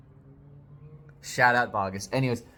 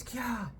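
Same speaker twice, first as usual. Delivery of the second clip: put-on girlish shriek; 13 semitones higher, 5 dB quieter than usual. Reading the daughter's cry aloud.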